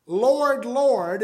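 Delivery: both "Lord" said loud with a falling tune; emphatic Lord Lord. Shown as a demonstration. Preacher demonstrating emphatic repetition to congregation, loud. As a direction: punch each "Lord" to show emphasis. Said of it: "is an emphatic way".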